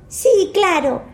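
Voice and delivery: put-on high eager little boy; child voice. George answers.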